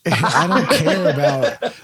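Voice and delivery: slow laugh through the words. Real, not acted. Laughing, slowed start.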